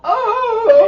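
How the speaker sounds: sudden high yelp of alarm; startled cry. Retold or imitated. Telling the morning mishap, a shocked high cry.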